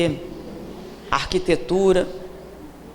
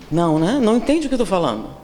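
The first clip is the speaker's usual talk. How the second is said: ordinary talk, nothing marked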